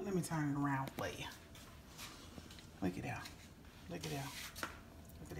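quick soft whisper. Real, not acted. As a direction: whisper it close to the mic. Whispering while showing cake.

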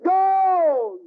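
high, loud shout of go; shouted command. Acted. Father urges his family up the hill, shouting. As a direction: bark it like a desperate father.

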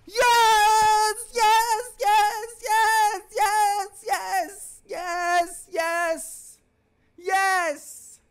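high-pitched repeated cheers, gradually softening; high jubilant cries trailing away; elated celebration. Real, not acted. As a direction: celebrate a goal, high and fading. Celebrating a goal.

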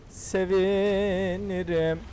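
a lingering playful repeat of Sevinirim; slow drawn-out sevinirim. Shown as a demonstration. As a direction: stretch Sevinirim out very slowly. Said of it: "çok sevinirim".

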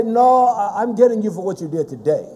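rapid-fire scolding, running on and on; scolding granny. Acted. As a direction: rattle off every old offence like a granny. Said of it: "As Granny would say".

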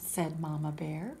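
ordinary delivery, nothing marked

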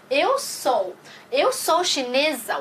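slow model sentence. Shown as a demonstration. Teacher models example sentence.

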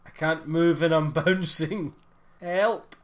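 loud, half-laughing cries for help; calling for help. Real, not acted. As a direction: yell for help while giggling. Stuck bouncing, calling for help.

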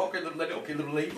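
low, soft placating mumble; backing-off crackhead. Retold or imitated. Man backing away from the car, meek and soft. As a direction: back down in a hushed voice. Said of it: "he was like".

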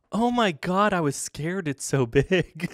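imitating a scared old lady, pitched high then dropping. Fisherman mimicking an onlooker's fright at his catch, scared old lady voice. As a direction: play an old lady's high, startled exclamation. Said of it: "you hear this old lady go".

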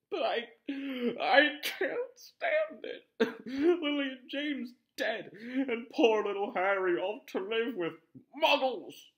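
loud, high, slow sobbing lament; wailing cry. Acted. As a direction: weep as if your heart breaks. Hagrid weeping over orphaned Harry.

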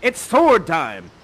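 loud, drawn-out heroic announcement; battle declaration. Acted. Young man ready to defend his honor, loud declaration. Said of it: "And he says".

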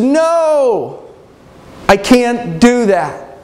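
high, drawn-out cry of refusal; anguished refusal. Acted. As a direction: stretch it out high, then slow down. Joseph's last refusal to the voice.

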